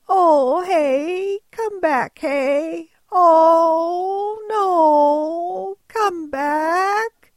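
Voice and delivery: high, loud pleading sheep calls; sheep crying out. Retold or imitated. Sheep begging the departing driver to come back, high and loud. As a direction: whine after them like abandoned sheep. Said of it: "and they cried".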